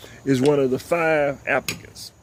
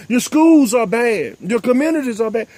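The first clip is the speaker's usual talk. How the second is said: quoting Trump; 8 semitones higher, 4 dB louder than usual. Retold or imitated. Host quoting Trump to his audience, emphatic mock. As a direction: hammer it out like a slogan. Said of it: "Donald Trump meant when he said".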